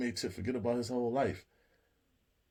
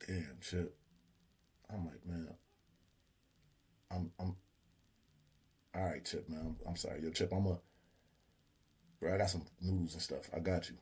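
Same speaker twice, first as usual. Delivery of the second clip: softer, lower sympathy; consoling; 4 semitones lower, 7 dB quieter than usual. Retold or imitated. Meech comforting the slapped Chip, soft and sympathetic. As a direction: comfort him gently.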